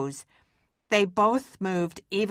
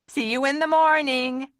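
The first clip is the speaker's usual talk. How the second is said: higher cheerful call; 7 semitones higher than usual. Acted. Maxwell calling goodnight to Mama. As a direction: call across the camp. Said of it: "called out to Mama".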